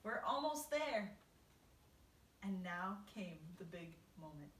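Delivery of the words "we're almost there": high, slow rallying call; shouting. A narrator's reading. Old green grasshopper looking out of tunnel, shouting. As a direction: stretch it out as a loud shout. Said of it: "shouted the old green grasshopper".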